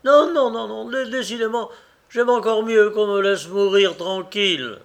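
high, loud cry of refusal; revolted outcry. A narrator's reading. Wounded man refuses the operation, crying out in revolt. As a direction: cry out in protest, pitched well up. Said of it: "eut une nouvelle révolte et s'écria".

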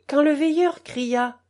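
ordinary delivery, nothing marked

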